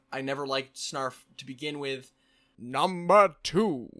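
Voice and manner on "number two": loud, very slow announcer call; countdown announcer. Acted. Announcing the next tick to viewers, loud and drawn out. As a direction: boom it out like a countdown host.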